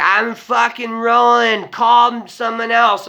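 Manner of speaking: high, loud, slow yell; drawn-out, high-pitched mock party shout; wasted party friend. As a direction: imitate a friend shouting over club noise.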